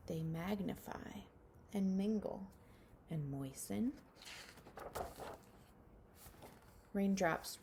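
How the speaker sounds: slow, hushed storybook reading with whispered lines; reading a picture book to children. Acted. Teacher reading a rain book to her class, hushed storybook voice. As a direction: read it gently to little ones, letting some lines fall to a whisper.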